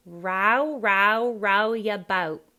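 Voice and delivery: loud ROW as rao, wide ow; "boat" as ba-oo, slow; Cockney ow vowel. Shown as a demonstration. Coach voicing Horace's line, Cockney ow vowel. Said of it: "really do it like ow".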